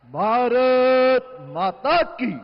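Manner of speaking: slow, loud rally call; roaring rallying speech. Real, not acted. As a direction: roar it out to the crowd. Speaker opens with slogans. (गरजता हुआ नारा)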